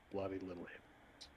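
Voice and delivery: muttered under the breath; contemptuous mutter. Acted. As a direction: spit it out softly, disdainful.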